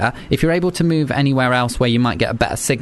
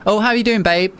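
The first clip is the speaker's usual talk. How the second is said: bright, casual greeting; friendly; 8 semitones higher than usual. Retold or imitated. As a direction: say it like greeting a mate. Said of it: "that's just friendly".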